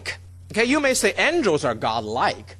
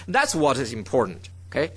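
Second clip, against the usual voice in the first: normal talking voice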